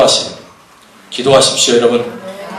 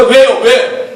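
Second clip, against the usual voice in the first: ordinary talk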